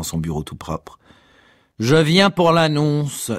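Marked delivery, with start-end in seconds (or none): 1.8-3.3 slow, booming announcement; stentorian. A narrator's reading. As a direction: proclaim it slowly, raised in pitch. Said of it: "d'une voix de stenteur".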